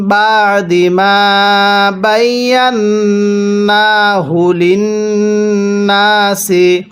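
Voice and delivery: slow, drawn-out tajweed chanting; slow Quran recitation. Shown as a demonstration. Teacher demonstrating recitation to students, slow chant. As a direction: recite each syllable long and measured. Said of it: "তাহলে".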